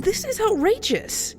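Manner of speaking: outraged. Acted. Beauty protests relocation to farm.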